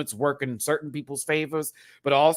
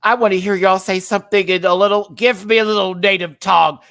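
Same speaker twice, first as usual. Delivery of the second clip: imitating [name]; 6 semitones higher, 10 dB louder than usual. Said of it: "She said".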